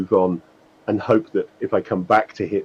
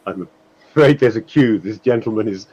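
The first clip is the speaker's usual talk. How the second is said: loud complaint; 3 semitones higher, 4 dB louder than usual. Pretend. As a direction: complain loudly about the hold-up, half laughing. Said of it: "loudly saying".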